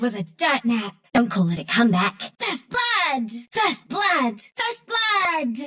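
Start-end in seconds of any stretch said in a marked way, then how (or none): 2.4-5.6 high, exultant exclamation; triumphant cry. Acted. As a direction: crow over the first kill, pitch up high. Announcing first kill in game.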